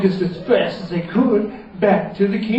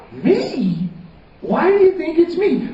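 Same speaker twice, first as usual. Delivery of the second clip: surprised wizard; 12 semitones higher than usual. Acted.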